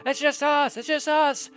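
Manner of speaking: high, loud pleading shouts; desperate knights hollering at the gate; frantic calling out. Acted. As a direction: yell to be let in, high and urgent. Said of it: "are kinda waving and go".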